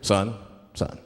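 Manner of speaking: low, measured cop voice; deep, deliberate officer voice; police officer. Acted. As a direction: play a stern patrolman at a traffic stop. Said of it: "Like, the police pull me over".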